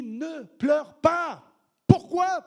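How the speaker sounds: drawn-out command then ringing why; slow emphatic quote. Acted. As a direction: stretch it out, then punch the question. Jesus quoted, then question.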